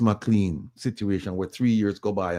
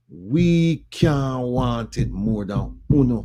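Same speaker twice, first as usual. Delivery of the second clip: "We can't want it more than uno" drawn out slow, each word weighted; slow emphatic; 2 semitones higher, much slower than usual. Real, not acted. To Jamaican listeners, slow deliberate emphasis on the point. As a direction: lean on every word, slowly. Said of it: "I'm emphasizing it".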